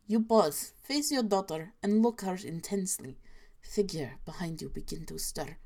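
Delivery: hushed fake Russian accent, lightly done; inaccurate Russian accent. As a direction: build dread softly in a mock Russian accent. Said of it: "inaccurate Russian accent".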